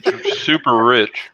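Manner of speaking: talking through a mouthful of cheese; words round a bite of food; mouth full. Real, not acted. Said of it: "Hey, don't talk with your mouth full".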